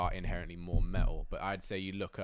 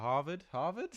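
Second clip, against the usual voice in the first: laughing; 11 semitones higher, 11 dB louder than usual. Real, not acted. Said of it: "testing the word, laughing".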